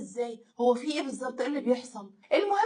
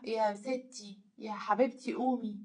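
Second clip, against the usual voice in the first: low, soft coaxing; gentle waking; 5 semitones lower, 9 dB quieter than usual. Retold or imitated. Husband waking his wife at night, soft and low. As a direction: coax a sleeper awake softly.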